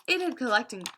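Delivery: ordinary delivery, nothing marked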